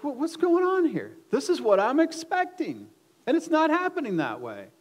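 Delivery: high, loud, baffled protest; confused indignation. Retold or imitated. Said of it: "I was all confused".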